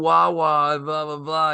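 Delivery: high, drawn-out Philadelphia vowels mocking the actress; mock Philly accent. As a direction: ham up a Philly accent parody. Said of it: "She learned how to say".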